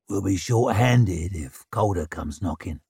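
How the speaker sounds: grim and dry; gruff man voice. Acted. Shivers low voice.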